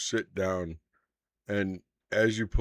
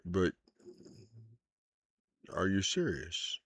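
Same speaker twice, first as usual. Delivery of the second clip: put-on earnest, probing older man; steady, serious inquiry; lightly voiced old hunter. GM plays Van Richten confessing.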